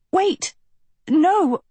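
high-pitched cry of alarm; screaming. A narrator's reading. Sophie screams as the bird drops Agatha, alarmed. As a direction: cry out sharply, voice leaping high. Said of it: "Sophie screamed".

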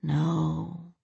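a slow, soft murmur. A narrator's reading. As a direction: murmur it slowly, barely voiced. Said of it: "Lorelei murmured slowly".

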